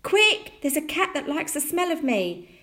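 put-on high, loud boy's plea; urgent shout. A narrator's reading. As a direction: shout in alarm to be let in. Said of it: "he shouted".

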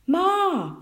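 put-on high, loud boy's call for mother; shout. A narrator's reading. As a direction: holler for Ma. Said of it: "shouted Tom".

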